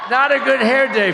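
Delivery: laughing loud self-mockery. Real, not acted. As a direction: laugh through it at yourself. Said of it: "I'm studying my hair".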